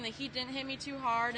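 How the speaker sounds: ordinary talk, nothing marked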